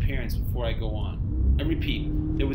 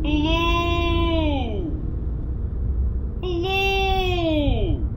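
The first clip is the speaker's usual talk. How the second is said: high, drawn-out eerie call from the roadside; hailing call; much slower than usual. Acted. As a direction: stretch each hello out like a ghostly hail. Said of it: "He hailed me this time".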